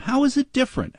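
high mocking squeak; mock question. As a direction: echo the claim in a high sneer. Mocking the other side's claim.

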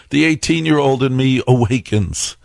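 ordinary talk, nothing marked

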